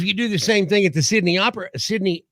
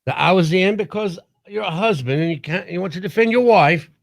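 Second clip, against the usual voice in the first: slightly raised mock Australian accent; 3 semitones higher than usual. Shown as a demonstration. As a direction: imitate an Australian man explaining himself. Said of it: "Australian accent".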